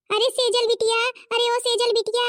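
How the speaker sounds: loud, high call across the house. Acted. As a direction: call for your daughter to come. Calling out for the daughter. (बेटी को ज़ोर से पुकारना)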